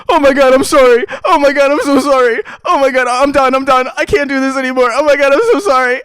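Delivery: high, loud frantic sorry; a distraught friend blurting apologies; panicked apology. Retold or imitated. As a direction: gush apologies like you hurt your friend. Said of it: "Liam on the other side of this bush go".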